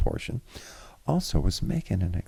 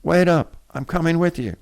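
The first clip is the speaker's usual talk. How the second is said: high, loud urgent holler; 12 semitones higher, 7 dB louder than usual. A narrator's reading. Jean Noël hollers after Alice leaving the house, urgent holler. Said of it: "Jean Noël hollered urgently".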